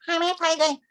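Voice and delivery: quick, high-pitched mock Australian hello; fast Aussie greeting. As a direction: run the mate greeting together quickly. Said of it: "they said it fast".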